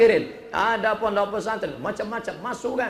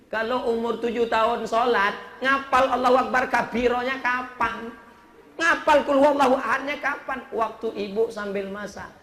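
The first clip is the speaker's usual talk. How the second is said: ordinary talk, nothing marked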